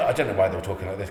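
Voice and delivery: ordinary talk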